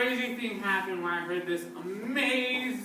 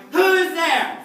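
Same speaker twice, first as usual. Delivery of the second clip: loud high call into the dark; 9 semitones higher, 11 dB louder than usual. Acted. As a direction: call out to the empty room. Calling out in the dark.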